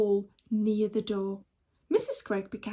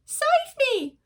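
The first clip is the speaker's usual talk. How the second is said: high, loud desperate cry; cry for help; 7 dB louder than usual. Acted. Child calling for rescue, high and frightened. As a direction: yell out to be saved.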